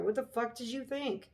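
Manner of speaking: ordinary delivery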